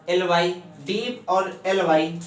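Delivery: slow, each part said apart: डीप, then एलवाई; split into syllables. Shown as a demonstration. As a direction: spell the word out slowly piece by piece. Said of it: "इसके दो भाग अगर हम लोग करें". (धीरे-धीरे, हिस्सों में अलग-अलग बोलना)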